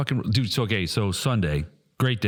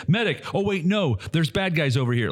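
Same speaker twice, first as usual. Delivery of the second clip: mock player call; 7 semitones higher than usual.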